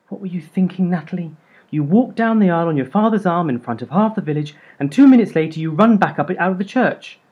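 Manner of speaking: high, loud exasperated scolding; scolding mum. A narrator's reading. Mum scolding her runaway bride daughter, high and loud. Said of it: "Mum went on".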